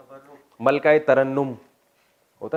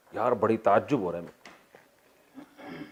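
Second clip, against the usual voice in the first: mock amazement; 4 semitones lower, 5 dB quieter, slower than usual. Shown as a demonstration. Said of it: "آپ کو یہ مثال سن کے تعجب ہو رہا ہوگا".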